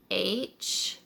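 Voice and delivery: ordinary delivery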